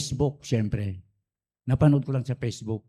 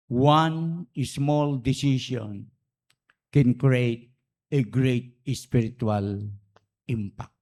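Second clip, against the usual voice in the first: slow solemn emphasis; 3 semitones lower, much slower than usual. Real, not acted. Solemn slow sermon point.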